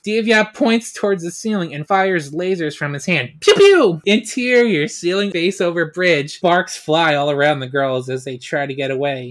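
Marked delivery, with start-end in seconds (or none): 3.4-4.0 high, loud mouth laser pew; laser sound effect. Acted. Deviat fires lasers at the ceiling, loud pew noises. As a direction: spit out a cartoon blaster noise.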